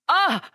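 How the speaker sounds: sharp high cry of pain; sudden loud ouch; pained yelp. Acted. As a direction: yelp as the shin is kicked. Kicked in the shin.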